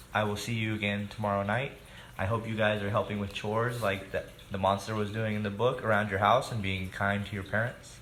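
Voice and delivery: plain reading voice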